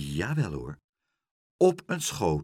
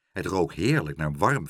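plain reading voice

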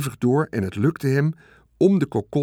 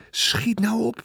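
urgent hurry-up; 11 semitones higher than usual. A narrator's reading. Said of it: "zei Wilbur".

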